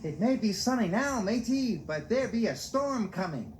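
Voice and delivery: high, teasing parrot of the sailor; mocking sailor voice. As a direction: mimic him to make fun. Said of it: "Salty knew they were making fun of him".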